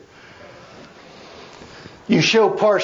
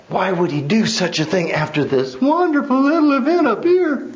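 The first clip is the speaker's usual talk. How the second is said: much higher, pitched up with excitement; rising fervour; 10 semitones higher than usual. Acted. Preacher telling the congregation how Jesus rips the temple up, fired up.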